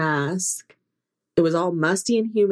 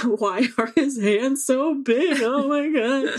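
laughing; 7 semitones higher, slower than usual. Retold or imitated. Reenacting crying on the ride.

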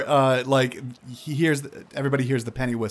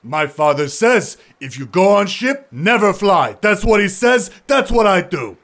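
loud, higher stubborn insistence; Zandros's voice; 7 semitones higher, 7 dB louder than usual. Acted. Zandros insisting to Tavar, loud stubborn voice. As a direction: quote his father, unmoved. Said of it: "He's like".

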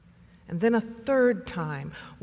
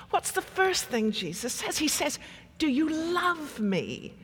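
put-on higher, lilting South African accent; imitated South African accent; 5 semitones higher than usual. Parishioner explaining loving Jesus to an intern, South African accent. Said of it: "here I wish that my South African accent was better".

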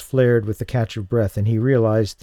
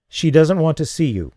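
hard voice; 6 semitones higher, 4 dB louder than usual. A narrator's reading. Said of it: "the hard voice repeated".

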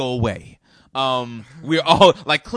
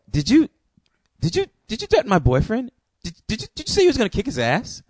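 higher, louder accusing barrage; angry confrontation; 11 semitones higher, 5 dB louder, faster than usual. Retold or imitated. Girlfriend confronts him over the threat, high and angry. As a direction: fire repeated questions at him, much higher. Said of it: "a little baby pit bull just goes off on me".

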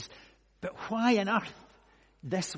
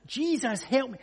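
higher, raised cry for help; insistent shout; 8 semitones higher, 5 dB louder than usual. Retold or imitated. Blind man calling past those who shush him, insistent shout. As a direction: call out insistently, short of a full yell. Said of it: "he shouts all the louder".

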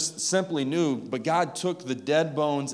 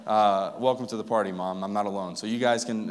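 normal talking voice

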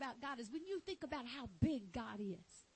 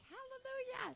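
high, soft squeal of praise; acted-out high-pitched rejoicing; delighted hallelujah; 10 semitones higher, 4 dB quieter than usual. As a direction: let it float way up high. Acts out own delighted reaction.

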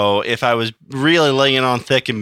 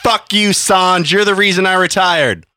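mock angry; 9 semitones higher, 5 dB louder than usual. Mock outburst at co-host.

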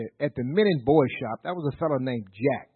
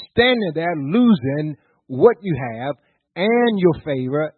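full-force pulpit preaching; fiery preaching; 2 semitones higher, 6 dB louder than usual. Acted. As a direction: drive it home to the congregation.

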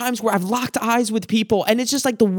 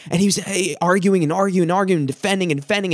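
normal talking voice

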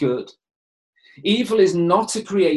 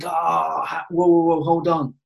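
gut-wrenching protest. Retold or imitated. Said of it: "there's a gut-wrenching".

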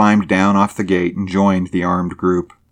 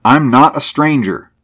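higher, louder, emphatic denial; exclaimed protest; 7 semitones higher, 5 dB louder than usual. A narrator's reading. Driver tells armed men he belongs, exclaimed protest. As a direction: protest firmly with a raised voice. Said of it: "he exclaimed".

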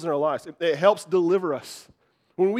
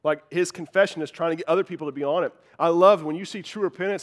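normal talking voice

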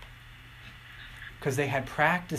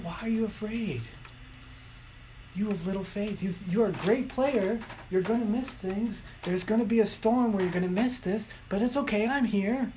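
gentle reassurance; 7 semitones higher than usual. Retold or imitated.

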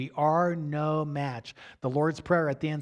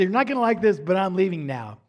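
louder, quicker, pleased replay of a best line; proud replay; 4 dB louder than usual. Retold or imitated. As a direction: repeat it brightly, proud of the line. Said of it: "it was my best line".

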